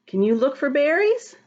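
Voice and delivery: usual speaking voice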